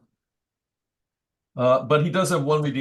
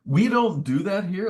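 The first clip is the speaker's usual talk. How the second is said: slow, higher, mock scolding; mock church lady; 8 semitones higher, 5 dB louder than usual. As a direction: drag it out like a disapproving matron. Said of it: "why would somebody even say that".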